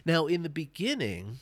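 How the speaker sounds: normal talking voice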